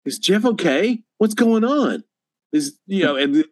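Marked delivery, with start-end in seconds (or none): 0.0-2.0 raised pitch, worked-up questioning; imitated alarm. Cam walking out to ask about Jeff, imitated alarm. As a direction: act out a friend getting everyone worked up. Voicing Cam's worried questions.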